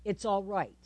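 soft, low reassurance; calm Jesus. Acted. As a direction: reassure very quietly. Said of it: "calmness in his voice".

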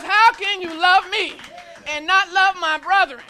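loud, rising preacher's cadence; preaching at full force. Real, not acted. Preacher urging the congregation to praise. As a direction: drive each praise line home.

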